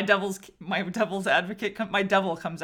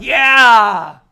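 cheering shout; 17 dB louder, much slower than usual. Retold or imitated. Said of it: "you're like".

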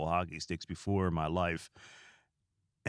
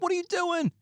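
very high, louder startled cry; shocked outburst; 3 dB louder than usual. Retold or imitated. Retelling yelling at the spraying cat, shrieking high. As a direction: yelp it in disbelief.

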